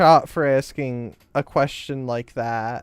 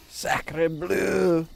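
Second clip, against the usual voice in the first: mock French accent; 4 dB quieter than usual. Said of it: "how you react to the French".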